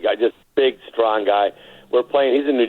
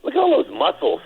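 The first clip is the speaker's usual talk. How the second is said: high, lightly mocking Larry voice; Larry teasing; 13 semitones higher than usual. Larry mocking a muscular opponent, lightly voiced high teasing. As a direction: tease it up high like Larry sizing him up. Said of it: "He goes".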